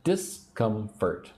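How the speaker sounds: discomfort slowly, in three separate beats; syllable by syllable. Shown as a demonstration. Teacher leading learners to repeat, Discomfort broken into slow syllables. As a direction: sound out Discomfort as dis, com, fort. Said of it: "say the word discomfort with me".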